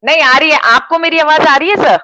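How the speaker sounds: speaking up to be heard. Real, not acted. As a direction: call out loudly over a weak line. Checking a bad call connection.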